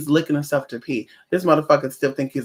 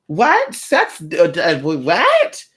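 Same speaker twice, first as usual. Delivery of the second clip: mock shocked innocence. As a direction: play it off like a clutching-pearls prude. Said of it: "And they'll play it off".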